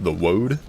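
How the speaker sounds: "wode" said with no R sound at all. Shown as a demonstration. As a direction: drop the R in "wode", like "wohd". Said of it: "I will no longer use the word".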